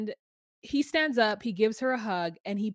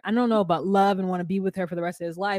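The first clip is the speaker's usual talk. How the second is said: ordinary delivery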